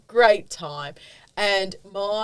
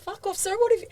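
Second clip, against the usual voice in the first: indignant; 13 semitones higher than usual. Real, not acted. Friend angry on her behalf.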